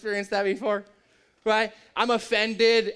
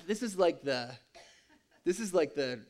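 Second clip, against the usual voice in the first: usual speaking voice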